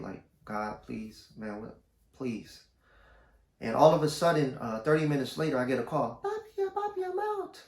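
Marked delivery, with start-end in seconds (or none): 0.4-2.6 quiet prayer. Retold or imitated. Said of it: "I kinda prayed". 6.2-7.6 put-on very high falsetto girl voice. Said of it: "My regular voice".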